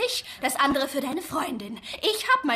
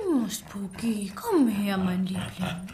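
cooing to the dog; 5 semitones lower, slower than usual. Acted. Coos to her dog.

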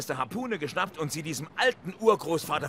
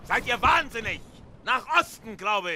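yelling far higher and louder in alarm; alarmed shout; 10 semitones higher, 4 dB louder than usual. Acted. Boomer yells back at the frantic Ahab: alarmed shout.

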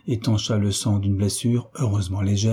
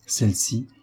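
plain reading voice

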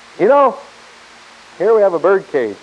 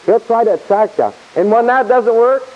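ordinary talk

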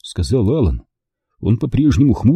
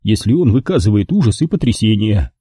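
ordinary delivery, nothing marked

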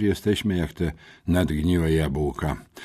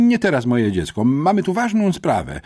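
higher, louder brisk principal; headmistress; 7 semitones higher, 5 dB louder than usual. A narrator's reading. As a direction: brush the girl off. Headmistress dismissing. (dyrektorka odprawia uczennicę, głośniej)

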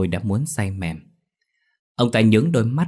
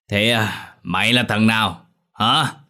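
put-on drunken menace; 2 semitones higher, slower than usual. A narrator's reading. Drunk father to Tý.

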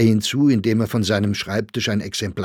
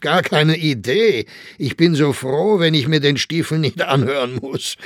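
higher, louder teasing Viennese crony; teasing Grossinger; 8 semitones higher, 4 dB louder than usual. A narrator's reading. Grossinger teases Saxberger about his absence, mock-jovial. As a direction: needle him with a knowing laugh.

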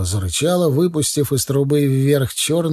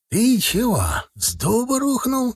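very high incredulous cry; narrated shout; 11 semitones higher than usual. A narrator's reading. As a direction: exclaim high, without added volume. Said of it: "крикнул Маленков". (высокий возмущённый выкрик, негромко)

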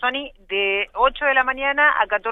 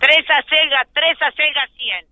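hard sell ad; 5 semitones higher than usual. Retold or imitated. Reporter mimics market vendor cries.

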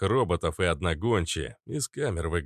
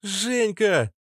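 put-on very high girlish squeal; high-pitched excited cry; 13 semitones higher, 4 dB louder than usual. A narrator's reading. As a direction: shriek a loved one's name. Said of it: "Знакомый голос взвизгнул слева".

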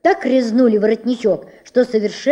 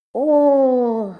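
mock exclamation; 4 semitones higher, 3 dB louder than usual. A narrator's reading. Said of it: "воскликнул воротничок".

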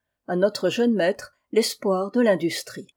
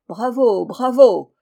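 second bravo much louder; crowd cheering; 3 semitones higher, 7 dB louder, slower than usual. A narrator's reading. Workers cheering their master, growing louder. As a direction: call out the bravos, building to a loud cheer. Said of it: "cria-t-on de toutes parts".

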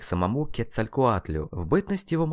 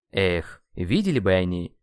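ordinary delivery

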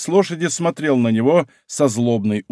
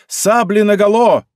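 loud, high rallying cry; shouted command; 7 semitones higher, 5 dB louder than usual. A narrator's reading. King to his squadron in battle, shouted command. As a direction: cry out the order to draw swords. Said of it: "в свою очередь закричал".

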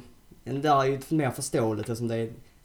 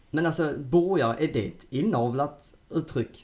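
ordinary talk

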